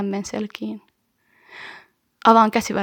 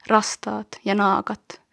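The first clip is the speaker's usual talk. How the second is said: slow; slower than usual. Acted. Slowed line amid whispers.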